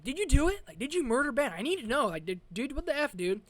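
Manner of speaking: loud, high, pressing demand from a dad; half-shouted dad grilling his son. Retold or imitated. Dad confronts son in car.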